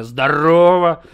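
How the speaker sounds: slow, loud, drawn-out hello; drawn-out greeting. Shown as a demonstration. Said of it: "такое тебе это как эксклюзивное".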